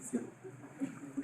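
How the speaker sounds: slow whispered check-in; hushed slow yes or no; whispered aside. Real, not acted. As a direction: whisper it to the class. Aside question to class.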